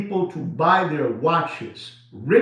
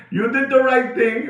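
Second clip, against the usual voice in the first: said through a laugh, pitched higher; laughing while praising a friend; teasing a friend with a laugh; 7 semitones higher than usual. Real, not acted.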